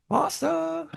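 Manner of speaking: very high, squeaky imitation of a cartoon German guy; German guy impression. Host imitating a South Park German guy, squeaky and high. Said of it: "the fucking German guy's like".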